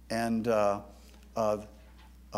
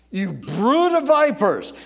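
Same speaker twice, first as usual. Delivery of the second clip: much louder and higher, slow and stretched; thundering rebuke; 7 semitones higher, 10 dB louder than usual. Acted. Jesus thundering at the Pharisees, a louder higher rebuke. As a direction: let the repeat thunder at them. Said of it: "Now, he says".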